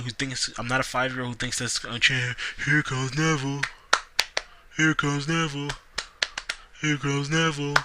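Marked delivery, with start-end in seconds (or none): 2.6-7.8 lightly hammed announcer call, repeated; a hint of a ring announcer hyping an entrance; mildly mocking commentator chant. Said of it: "they're like".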